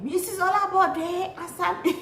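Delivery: high, slow mock shout with a laugh; shouted name. Bride retelling shouting for her mom at the door, high slow call. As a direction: call her mother across the church, half laughing. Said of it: "I just shouted her name".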